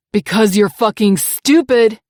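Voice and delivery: loud contemptuous insult; angry abuser insult. Acted. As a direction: sneer it loudly.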